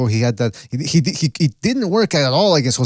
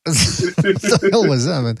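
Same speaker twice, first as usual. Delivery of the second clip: laughing; 4 semitones higher, slower than usual. Real, not acted. Laughing at surfer voice.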